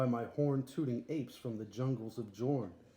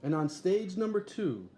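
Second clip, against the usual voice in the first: ringmaster announcement; 6 semitones higher, 4 dB louder than usual. Acted. Ringmaster announcing the next act.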